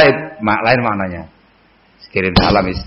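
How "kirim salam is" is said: "Kirim salam, is" diucapkan rendah dan singkat; kirim salam putus. Shown as a demonstration. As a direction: ucapkan "is" dengan cepat seperti memutus hubungan. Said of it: "Dengan intonasi yang berbeda". (intonasi putus pada "Kirim salam, is")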